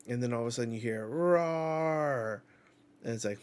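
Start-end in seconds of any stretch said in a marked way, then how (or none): 1.1-2.4 monster roar. Acted. Monster roar heard in scene.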